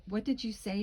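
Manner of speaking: ordinary delivery, nothing marked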